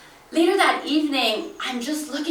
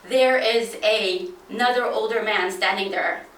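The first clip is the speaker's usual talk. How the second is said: usual speaking voice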